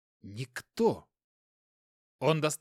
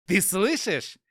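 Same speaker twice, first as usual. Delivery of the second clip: gloating laugh; 3 semitones higher, 8 dB louder, slower than usual. Acted. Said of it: "Он рассмеялся".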